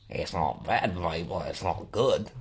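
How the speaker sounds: low, very soft put-on Australian; [name]. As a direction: give the verdict as a quiet Australian coach. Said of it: "[name], tell me what you think".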